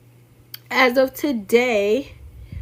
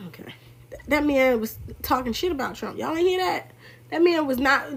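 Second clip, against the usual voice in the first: ordinary talk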